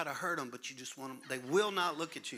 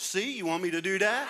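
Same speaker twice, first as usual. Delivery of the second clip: fast, loud, higher mock threat; loud fast joking parental threat; 5 semitones higher, 10 dB louder than usual. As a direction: threaten the kid in jest, fast. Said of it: "parent threatens".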